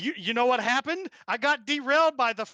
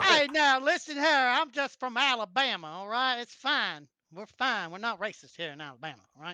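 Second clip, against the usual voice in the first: put-on Southern accent; 3 semitones higher than usual. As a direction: plead your case in a Southern accent. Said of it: "suddenly got a Southern accent".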